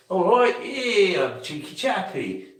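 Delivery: high, slow mock Cockney accent; cheeky chappy Cockney impression; Hollywood Cockney. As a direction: put on a stagey Cockney accent. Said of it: "Hollywood Cockney".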